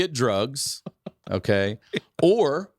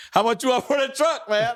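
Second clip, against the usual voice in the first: a lightly voiced street guy, pitched up; pushy street buyer; 3 dB louder than usual. Hustler at the stoplight asks to buy the truck, pitched up. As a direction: tease him like a hustler at a stoplight.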